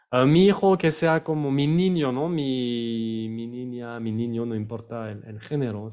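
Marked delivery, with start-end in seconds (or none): none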